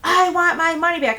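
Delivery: imitated yell. Angry woman demanding her deposit from the landlord, yelling. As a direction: yell it like an irate customer. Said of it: "the woman yelled to me".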